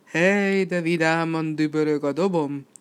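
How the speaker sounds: bellowed out, long held opening; full-lunged bellow. A narrator's reading. Red devil glorying in his drum, full-lunged bellow. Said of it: "teli tüdőből ordibált".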